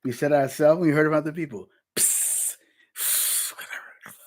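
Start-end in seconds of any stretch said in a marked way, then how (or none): 2.0-4.2 hissing whispered psst; serpent whispers. Retold or imitated. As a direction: whisper like a sneaky snake. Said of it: "Sound like a bunch of serpents".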